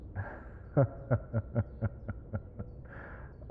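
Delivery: soft, drawn-out derisive chuckle; mocking chuckle. Prisoner laughing off the captain's accusation, mocking chuckle. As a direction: chuckle quietly and at length, as if the accusation amuses you.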